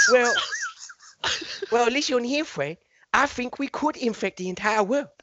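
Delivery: put-on high comic Chinese accent, second man. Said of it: "I may do it in a Chinese accent".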